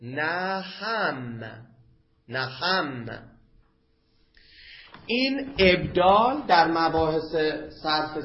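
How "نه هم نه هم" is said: "نه هم" said as one word, naham, with h replacing the ayn; ayn turned to ha. Shown as a demonstration. Teacher showing a dialect form to students, "نه هم" pronounced naham. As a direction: say "نه هم" as naham, drawn out, then repeat it. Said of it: "و بعضهم یبدلها هاء".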